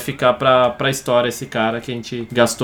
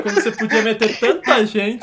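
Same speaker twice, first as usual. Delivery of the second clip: higher voice, still laughing; laughing teasing; 7 semitones higher than usual. Real, not acted. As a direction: protest to a friend through real laughter. Teases friend while cracking up. (reclamando aos risos)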